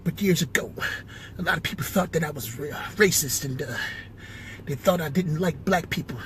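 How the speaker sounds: soft, measured presidential address; George W impression. President telling people he loves them, soft George W. voice. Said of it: "hear more George W".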